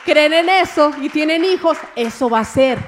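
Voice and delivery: loud, emphatic promise to believers; full-force preaching. Real, not acted. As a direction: proclaim it loudly to the congregation.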